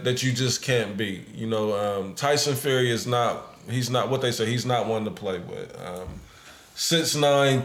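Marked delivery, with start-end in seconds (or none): none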